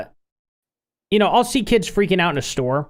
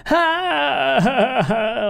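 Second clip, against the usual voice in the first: high, pitiful mock wail; mock baby sobs, high and sad; imitated sad baby cry; 9 semitones higher, much slower than usual. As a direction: imitate a heartbroken baby crying, not screechy. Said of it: "she cries in a way that's so sad".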